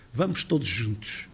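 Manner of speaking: low, soft Azorean Vamos todos juntos; Azorean accent. Shown as a demonstration. Lecturer lightly imitating Azorean speech, low and soft. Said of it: "nos Açores".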